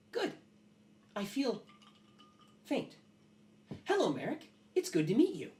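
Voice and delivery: high, halting metal creature; Alloy. Acted. Metal creature meeting a guest, high and stilted. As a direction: greet in a high voice, words spaced apart. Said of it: "And Aloy goes".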